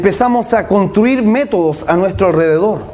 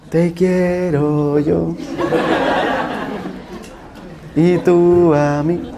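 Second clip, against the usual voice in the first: slow, syrupy, overly nice; mock the pastor who forces himself to be gentle; lightly voiced saccharine pastor; sweet pastor voice; 2 semitones lower, 4 dB quieter, much slower than usual. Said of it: "el pastor que era medio así acelerado".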